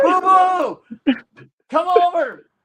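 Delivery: high, carrying call to his daughter. Retold or imitated. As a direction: call her over from the stands. Dad calls daughter from stands.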